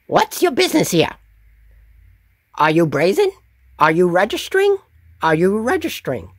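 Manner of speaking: high, quick nasal-free goblin questioning; goblin guard. Acted. Goblin guard questioning Raza and Varric, high quick goblin voice. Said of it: "the goblin townsguard says".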